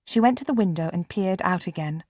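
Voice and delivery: usual speaking voice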